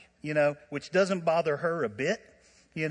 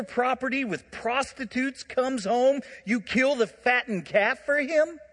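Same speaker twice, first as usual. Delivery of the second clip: raised, indignant protest to the father; bitter complaint; 8 semitones higher, 4 dB louder than usual. Acted. Older brother protesting to his father, indignant and raised. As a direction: complain loudly that it's unfair.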